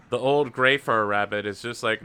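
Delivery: ordinary talk, nothing marked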